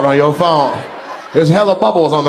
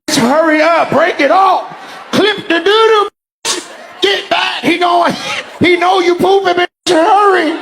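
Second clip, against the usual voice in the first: high-pitched, mock-frantic friend; squealed imitation of a panicking friend; urgent friend; 12 semitones higher than usual. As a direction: shout a hammed-up hurry-up warning. Acts texting friend urging her on.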